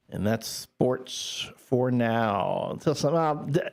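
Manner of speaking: slow, deliberate sign-off; sportscaster voice. Shown as a demonstration. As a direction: announce it like a sports anchor. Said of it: "Was that your sportscaster voice?".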